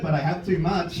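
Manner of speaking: ordinary delivery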